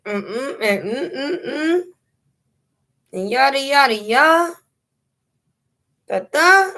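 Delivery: lightly voiced New York woman, rising and loud on yada; a hint of New York attitude, mm-mm and yada yada; female New York accent. Vlogger jokingly voicing what she'd say in New York accent. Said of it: "with my female New York accent".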